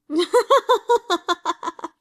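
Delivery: loud, high, harsh bark of laughter; a cornered prince's furious cackle. Acted. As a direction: burst out in a bitter barking laugh. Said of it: "before barking with laughter".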